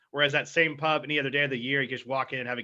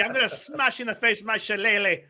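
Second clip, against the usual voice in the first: higher, louder hammed-up Scottish accent with a rolled threat; mock Scottish brogue; 7 semitones higher, 8 dB louder than usual. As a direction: play a Scottish tough guy ready to brawl, in a Scottish accent. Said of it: "that might be a little Scottish".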